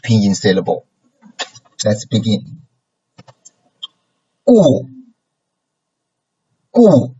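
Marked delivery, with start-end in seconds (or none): 4.5-7.1 GU in a steep fall; fourth tone. Shown as a demonstration. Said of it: "gu is a fourth tone".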